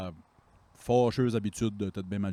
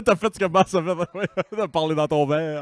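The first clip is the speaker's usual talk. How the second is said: laughing; 7 semitones higher, 8 dB louder than usual. Real, not acted. Friends laughing at the slip.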